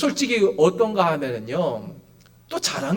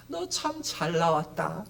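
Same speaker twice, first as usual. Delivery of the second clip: high-pitched, affectionate welcome; acted fond reunion voice; welcoming; 7 semitones higher than usual. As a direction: greet someone long missed, gushing a little. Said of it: "이러시는 게 아니라".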